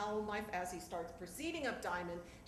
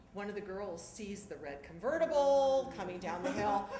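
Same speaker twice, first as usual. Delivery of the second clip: ordinary talk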